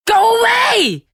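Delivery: high, loud panicked yell; terrified yell. Acted. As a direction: shriek it at the face. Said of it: "I yelled".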